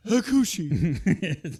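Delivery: imitated sneeze that bursts into the word, loud and drawn out; fake sneeze. Wrestler mocking opponents with a sneezed name, fake sneeze. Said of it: "then he fake sneezes and goes".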